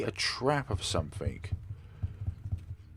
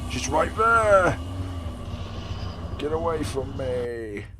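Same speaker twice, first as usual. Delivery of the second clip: high, slow frightened yell; terrified scream; 6 semitones higher, 4 dB louder, slower than usual. Real, not acted. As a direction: scream as she closes in. Monster right there, fleeing.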